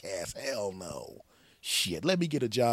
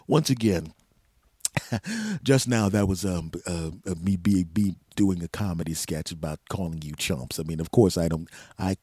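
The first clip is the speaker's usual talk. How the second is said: fake nice voice; 3 semitones lower, 6 dB louder than usual. Host covering his tracks with listeners, flustered fake nice. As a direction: lower nice-guy voice, louder and laughing nervously. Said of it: "that nice voice crap".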